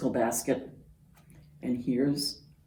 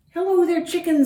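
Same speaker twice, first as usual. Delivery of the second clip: high, louder duck greeting; cheery Duck; 13 semitones higher, 6 dB louder, faster than usual. Acted. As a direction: sing out hello as Duck. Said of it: "said Duck".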